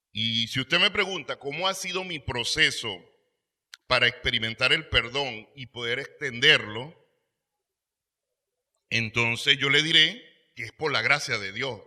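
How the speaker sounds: fervent preacher's rise; preaching. Real, not acted. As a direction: proclaim it to the congregation. Preacher testimony at force.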